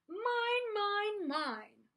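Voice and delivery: high, loud possessive cry that falls on the last word; roaring. A narrator's reading. Greedy dinosaur charging into the schoolyard, roaring. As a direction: roar it out greedily. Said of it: "they heard a roar".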